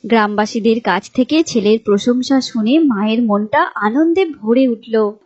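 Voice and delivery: ordinary delivery, nothing marked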